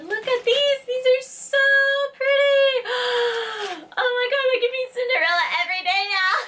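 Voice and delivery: high squealy delight; excited gushing. Real, not acted. Showing her viewers new shoes, high giddy gush.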